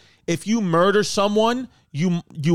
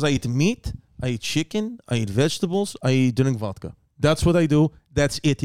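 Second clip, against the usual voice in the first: a low imitation in a Russian accent; mock Russian mover listing; 3 semitones lower than usual. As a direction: lower Russian-accented imitation, each item rising at the end. Mover listing his diet.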